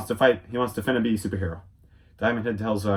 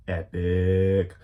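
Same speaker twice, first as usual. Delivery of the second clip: ordinary delivery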